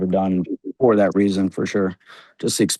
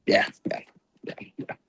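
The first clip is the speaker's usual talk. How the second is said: whispered, fading yeahs; quick hushed agreement; breathless yeahs; 4 dB quieter than usual. Retold or imitated. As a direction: whisper it like you're hushed by the nod. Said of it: "you're just like".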